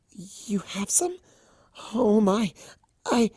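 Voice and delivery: shy mare hearing of sheep to adopt; excited Fluttershy. Acted. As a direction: gasp it out, overjoyed. Fluttershy gasping.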